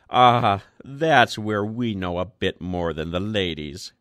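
plain reading voice

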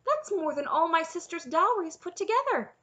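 high, loud outraged disbelief; incredulous. A narrator's reading. Princess comparing the reward with sisters' dowries, incredulous. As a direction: exclaim it in high-pitched disbelief. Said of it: "Cimmerian said incredulously".

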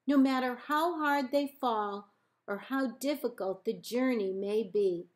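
ordinary delivery, nothing marked

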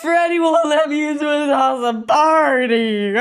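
loud, high hammed-up bawling; exaggerated tearful wail; wailing complaint. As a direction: bawl it out like a rejected child. [name] wails about being rejected.

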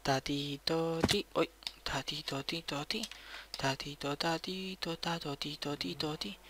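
soft coaxing call to an animal; hushed sing-song calling a pet. Real, not acted. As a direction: coo the name gently to lure it closer. Softly calling an animal over.

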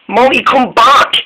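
high-pitched call after a friend; lightly voiced engine calling after a friend. Dennis chasing Molly downhill.

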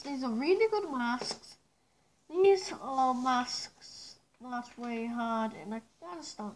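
muffled behind mask; speaking through a gas mask. Real, not acted. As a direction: voice through respirator. Seen 4: full face gas mask worn throughout labelled speech; references unmasked.

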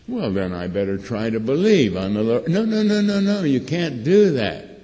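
ordinary delivery

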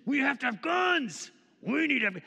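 high, loud caricature of gun enthusiasts; hammed-up insistent yell; mock clamouring. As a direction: shout it like a worked-up zealot. Mocking Christians clamouring for guns.

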